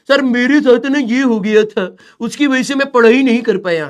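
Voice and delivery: mock a lazy kid whining his excuse; whiny student excuse. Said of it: "और ये बच्चे क्या करते हैं ना".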